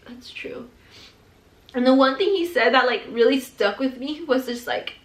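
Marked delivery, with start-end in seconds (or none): 0.1-0.7 very soft, almost whispered; hushed agreement. Retold or imitated. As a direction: let it drop to a near-whisper, quietly conceding. Recalling her hushed, moved agreement.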